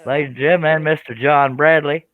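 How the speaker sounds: announcer introduction. Pretend. Host presents a guest to the livestream audience, grand announcer introduction. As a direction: draw out the guest's name like an announcer.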